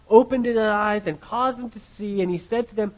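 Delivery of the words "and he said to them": ordinary delivery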